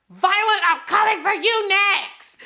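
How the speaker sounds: high, loud menacing villain imitation; playful impression of a menacing villain; mock villain threat. Voicing villain Jack's threat.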